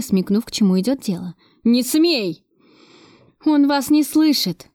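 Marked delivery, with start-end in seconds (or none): 1.6-2.4 mild angry yell. A narrator's reading. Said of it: "завопил Кравцов изображению на экране". 3.4-4.7 slow, higher-pitched exasperated remark; terse, contained irritation. A narrator's reading. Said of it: "процедила я".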